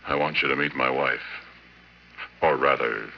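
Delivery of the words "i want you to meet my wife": ordinary talk, nothing marked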